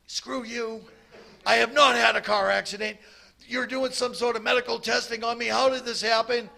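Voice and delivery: high, loud panicked protest in his head; trapped patient shouting inside his head, panicked; freaking out. Retold or imitated. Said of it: "I'm freaking out".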